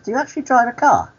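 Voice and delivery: very high, quick retold query; acted pointed question. Storyteller replays quizzing the cleaner about driving, high pointed question. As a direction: fire it off high and fast.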